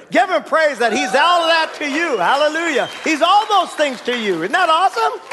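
high-pitched, exultant exhortation; rousing praise. Real, not acted. Preacher urges congregation to give God praise, excited. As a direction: stir the room up to praise.

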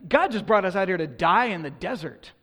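loud, bitter accusation; bitter complaint. Retold or imitated. Preacher voicing Israelites accusing God in the desert, loud. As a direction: throw the blame at God. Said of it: "their only attitude at this point is like".

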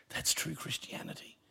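soft whisper that lands the point; whispered climax. Real, not acted. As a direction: breathe the line out quietly, almost to yourself. Quiet climax of the list.

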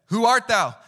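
loud, high alarmed question; startled challenge. Retold or imitated. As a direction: bark it out, caught off guard. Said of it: "imagine the emotion that he said".

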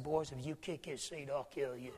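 put-on low, soft warning to the boys; hushed threat. Father warning his sons not to kick the seat, hushed. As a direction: lean in and threaten them under your breath.

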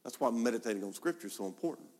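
ordinary talk, nothing marked

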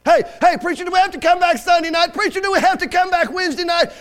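loud, high pestering of the preacher; hammed-up, high-pitched congregation grumble; mock complaining churchgoer. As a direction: heckle him like a griping member. Mimics complaining church members.

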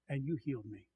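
very soft, low scripture line; hushed. Shown as a demonstration. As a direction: say it under your breath. Same verse repeated hushed.

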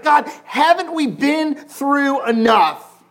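loud cry, last words slow; anguished shout. Retold or imitated. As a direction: shout the question up to heaven. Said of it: "you're like".

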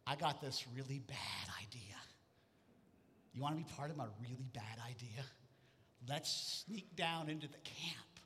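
soft, low whispered plotting; [name] whispering a bad idea to his friend; conspiratorial whisper. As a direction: hush it like a sneaky plan.

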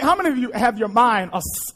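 usual speaking voice

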